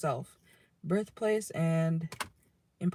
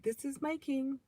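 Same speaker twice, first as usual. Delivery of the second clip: proud quote; 8 semitones higher, 5 dB quieter than usual. Retold or imitated. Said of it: "someone who says".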